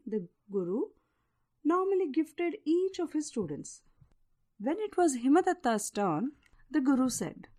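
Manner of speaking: steady storytelling voice; story narration. A narrator's reading. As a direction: tell it like a folk tale. Narrates a folk tale.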